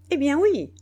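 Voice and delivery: high, loud, emphatic retort; brisk. A narrator's reading. General answering the baffled brigand, briskly. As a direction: come back sharply and loudly. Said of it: "reprit vivement Biassou".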